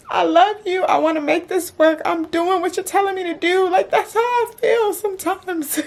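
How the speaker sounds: high, tearful plea; crying. Real, not acted. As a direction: plead through real tears, voice climbing. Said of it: "I think I just broke down crying".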